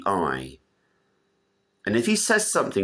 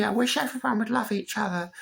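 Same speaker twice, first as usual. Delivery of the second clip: mock pious archbishop. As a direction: parody the naive do-gooder, pitched up. Mocking the Archbishop's niceness.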